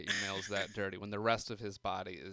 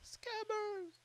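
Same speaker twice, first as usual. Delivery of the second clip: high gleeful blurt; excited squeal. Real, not acted. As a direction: shout the name with glee. Gleeful exclaim of wrong name.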